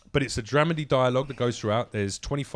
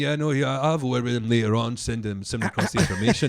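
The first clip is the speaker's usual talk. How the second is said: Scottish manager voice; 3 semitones higher, 5 dB louder than usual. Retold or imitated. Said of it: "I used to have a voice for him".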